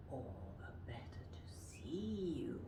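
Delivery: hushed, breathy wolf; whispered wolf. Shown as a demonstration. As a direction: whisper the wolf's line. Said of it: "And the wolf says".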